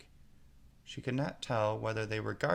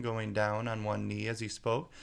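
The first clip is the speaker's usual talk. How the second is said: ordinary delivery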